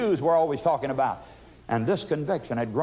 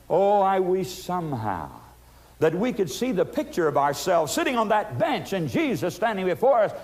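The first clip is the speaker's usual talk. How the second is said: usual speaking voice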